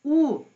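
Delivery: "ou" called out high and loud; isolated vowel sound. Shown as a demonstration. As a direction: punch out the vowel "ou" alone. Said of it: "Quand tu entends le son".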